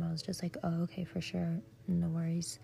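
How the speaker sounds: ordinary delivery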